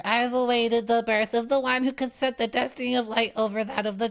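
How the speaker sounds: high, solemn godlike proclamation; powerful divine voice. Acted. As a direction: proclaim it like a deity from the light. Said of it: "met by a powerful voice".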